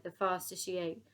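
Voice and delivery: plain reading voice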